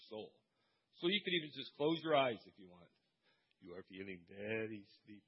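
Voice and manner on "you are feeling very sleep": low, soft hypnotist drone; joking hypnotist voice; mock hypnotist. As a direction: lull them like a stage hypnotist. Joking hypnotist voice after close eyes.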